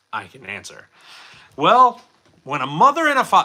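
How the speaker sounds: normal talking voice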